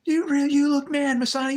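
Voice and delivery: high, loud barking drill instructor; drill sergeant yell. Retold or imitated. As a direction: scream it in the recruit's ear. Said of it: "He was yelling and screaming in my ear".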